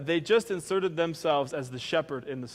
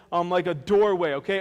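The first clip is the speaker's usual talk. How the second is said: usual speaking voice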